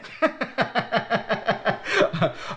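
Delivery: real staccato belly laugh; a long, chuckling run of laughter. Real, not acted. Vlogger amused by his own stubbornness, laughing hard.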